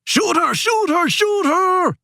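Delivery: high, frantic-paced repeated yell; urgent order yelled at a high pitch. Acted. Man yells to Georges as the Yukon is shot, yelling. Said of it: "he yells to Georges".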